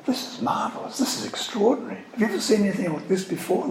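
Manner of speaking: hushed, hurried mock wonder; mock amazed crowd. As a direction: imitate onlookers marvelling in a light high voice. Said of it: "they're not saying".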